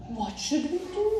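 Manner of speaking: put-on high little girl question; girl asking her parents what to do; child worried. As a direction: ask high and scared. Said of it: "said Adeline".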